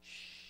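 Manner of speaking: long soft shush; hushing shh. Shown as a demonstration. Teacher telling three little boys to be quiet, long shush. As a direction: hush them with a held "Shh". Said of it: "what do you have to tell them?".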